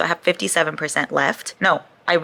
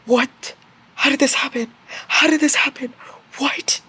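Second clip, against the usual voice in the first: high, shocked disbelief; stunned reader reacting; 9 semitones higher than usual. Real, not acted. Shocked at plot twist.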